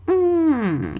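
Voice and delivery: drawn-out hesitant "hum"; a long, polite hesitation noise; thinking hum. Shown as a demonstration. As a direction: hum as if weighing an answer. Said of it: "aquele barulhinho que você faz quando tá pensando".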